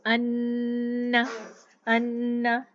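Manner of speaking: अन्ना with the doubled noon held nasal; अन्ना slow, hold the nn with nasal hum; nasal ghunna. Shown as a demonstration. Teacher demonstrates ghunna, slow recitation. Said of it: "नून मुशद्दद".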